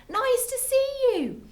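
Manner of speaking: very high, singsong hoot; hooted greeting. A narrator's reading. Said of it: "and they hooted".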